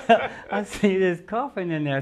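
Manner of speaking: high laughing through the words; laughing about the coffin. Real, not acted. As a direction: laugh while telling it.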